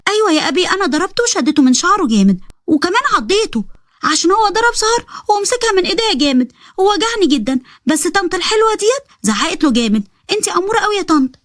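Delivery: put-on high childish bragging voice; proud little girl. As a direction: boast like a little girl to her brother. Girl tells brother proudly.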